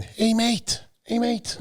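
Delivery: high-pitched impression of a man hailing someone; Neil Hill. Stranger calling the bodybuilder after a show, high put-on voice. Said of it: "That's my Neil Hill impression".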